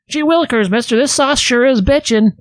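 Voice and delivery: put-on high, eager 1950s kid voice; chirpy old-timey kid; wholesome 1950s kid. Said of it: "as the fifties kids used to say".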